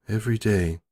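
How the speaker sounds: ordinary delivery, nothing marked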